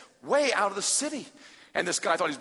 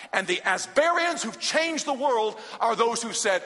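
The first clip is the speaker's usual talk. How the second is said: ordinary delivery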